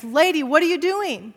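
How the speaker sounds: mock guard barking after a visitor; retold loud call. Security guard calling out to the pastor, loud and sharp. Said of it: "he spoke loudly and clapped his hands".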